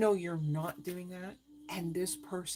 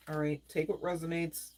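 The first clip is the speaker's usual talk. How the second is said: ordinary talk, nothing marked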